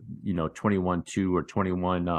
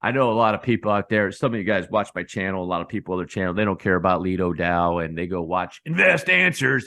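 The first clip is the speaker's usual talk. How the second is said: usual speaking voice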